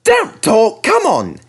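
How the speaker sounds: high, loud, impatient; irritable order; cross James. A narrator's reading. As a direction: engine snapping back at coaches. James snaps at coaches.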